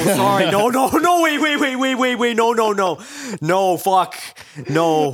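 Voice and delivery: high, loud, tumbling protest; frantic backtracking. Real, not acted. Speaker retracting an embarrassing misunderstanding, frantic. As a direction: frantically take it all back.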